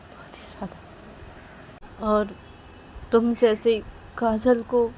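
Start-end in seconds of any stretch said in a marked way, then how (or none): 0.1-2.1 slow, soft confession whispered; tender whisper. Acted. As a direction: breathe it like a secret. Riya confesses love.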